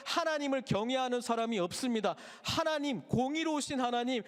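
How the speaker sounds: lamenting prophet, voice lifted high; pleading prophet. Acted. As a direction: cry out to God like Habakkuk. Prophet's plea to God acted out.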